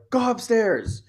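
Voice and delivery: sharp, high snapped order; lost temper. Retold or imitated. Mother losing it, ordering her daughter upstairs, sharp and high. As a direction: bark the order at the kid, losing it. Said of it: "I momentarily lost it".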